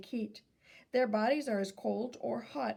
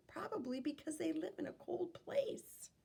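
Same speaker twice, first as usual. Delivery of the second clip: whisper; 7 semitones higher, 8 dB quieter than usual. Real, not acted. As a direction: breathe it out as a little secret. Reader's aside while reading to kids.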